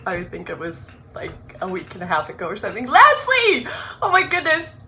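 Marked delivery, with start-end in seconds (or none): 2.9-4.8 much higher and louder, laughing; excited call. Real, not acted. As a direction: call out her name with joy. Calling out to a friend.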